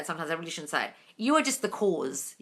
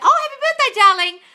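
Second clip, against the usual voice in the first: much higher and louder, gushing; excited congratulations; 10 dB louder than usual. Real, not acted. Host gushing happy birthday to a viewer, high and loud. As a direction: gush over the good news.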